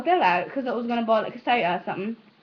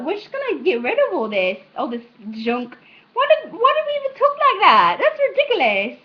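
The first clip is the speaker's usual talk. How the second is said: hammed-up colonists, much higher and louder, scoffing; mock colonist; 9 semitones higher, 8 dB louder than usual. Vlogger jokingly voices colonists rejecting English, in a shrill mock voice. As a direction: squeal it like a colonist mocking the old way of talking. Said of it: "they're like".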